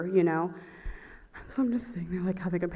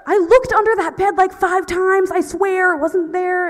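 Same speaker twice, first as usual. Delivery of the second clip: loud, high-pitched self-defense; indignant protest; 10 semitones higher, 9 dB louder than usual. Real, not acted. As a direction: insist on it at the top of your voice. Protesting about the lost wallet.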